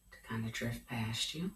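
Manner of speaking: calm, hushed yoga guidance; guided meditation. Acted. Leads a guided relaxation.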